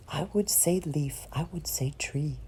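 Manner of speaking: unvoiced hush on the gentle words; whispered. Poet whispering the gentle images to the listener. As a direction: drop to a whisper for leaf and tree. Poem recital, sudden drop.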